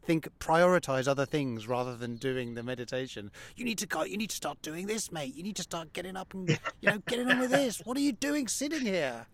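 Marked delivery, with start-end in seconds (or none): 3.6-9.3 nagging inner voice. Retold or imitated. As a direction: voice your restless mind hurrying you along. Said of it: "a part of you that's just trying to drag you away".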